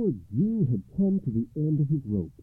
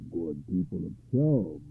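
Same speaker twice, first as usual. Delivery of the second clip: low, slow pronouncement; low-voiced deliberate judge; solemn judge; 7 semitones lower than usual. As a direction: address the town with slow gravity. Judge addresses the townspeople.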